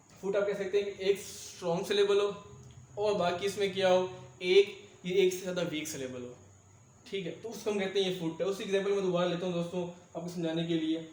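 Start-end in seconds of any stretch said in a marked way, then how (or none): none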